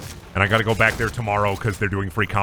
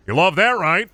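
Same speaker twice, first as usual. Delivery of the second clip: mock boss; 6 semitones higher, 4 dB louder than usual.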